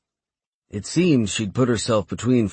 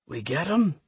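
raspy, strained croak; 7 semitones higher, 4 dB quieter than usual. Acted. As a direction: croak it out through burnt lungs. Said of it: "Dietrich croaked".

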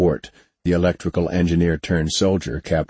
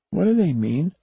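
put-on wide-eyed kid; 11 semitones higher than usual. A narrator's reading. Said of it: "wide-eyed".

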